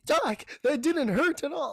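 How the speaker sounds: high, put-on Transylvanian vampire accent; mock a bad Transylvanian vampire accent. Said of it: "Her little vampire Transylvanian accent was".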